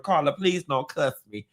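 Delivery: ordinary delivery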